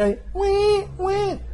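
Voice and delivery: high wailing newborn cry "wee, wee"; baby crying. Retold or imitated. Teacher imitates her own birth cry for the class, high wail. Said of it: "There was a baby inside my mother".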